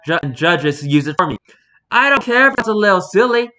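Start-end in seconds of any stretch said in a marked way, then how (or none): 1.9-3.5 emphatic. Retold or imitated. Emphatic reading, loud high.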